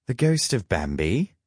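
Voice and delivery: incredulous sidekick's quip; mocking guess. A narrator's reading. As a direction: toss it out as a jibe, pitch climbing. Loki mocks the horned stranger.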